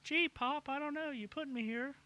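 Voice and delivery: put-on high-pitched little boy voice; kid voice. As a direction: play an eleven-year-old son, pitched high. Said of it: "I'm in character as a fucking kid".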